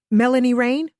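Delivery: rushed, urgent question; hurried. Acted. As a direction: blurt the name quickly. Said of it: "I hurried to say".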